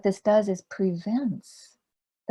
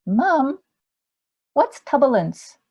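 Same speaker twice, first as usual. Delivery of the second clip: put-on high, louder child voice; little boy piping up; 6 semitones higher, 6 dB louder, slower than usual. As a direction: pipe up like a curious four-year-old. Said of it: "little boy piped up to his mom".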